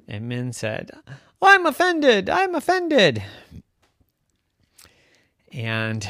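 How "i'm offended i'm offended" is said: high, hammed-up squeal of offence; mock offended. Min hurt by her friend's gossip, mock-offended squeal. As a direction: play it up like a wounded child.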